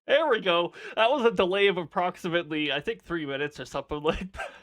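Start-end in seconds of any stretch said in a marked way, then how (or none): none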